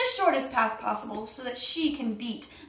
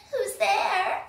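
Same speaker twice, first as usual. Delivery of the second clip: granny calling out; 9 semitones higher than usual. Acted. Granny calls from inside.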